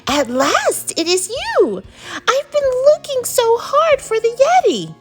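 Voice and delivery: put-on high, elated boy's exclamation; excited shouting. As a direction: cry out high with joyful relief. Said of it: "shouted George".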